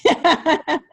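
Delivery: loud bright laugh; high laugh. Real, not acted. Laughing after thanks.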